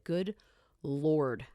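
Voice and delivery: ordinary delivery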